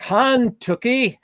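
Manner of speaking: movie Kentucky. Retold or imitated. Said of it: "how does Daniel Day-Lewis say Kentucky".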